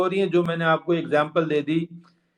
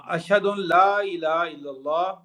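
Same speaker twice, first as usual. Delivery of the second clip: Arabic recitation voice, ending on مُحَمَّدًا with "dan"; recited Arabic. Shown as a demonstration. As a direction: recite it the way you'd say it in prayer. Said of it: "پڑھتے ہیں، محمدًا".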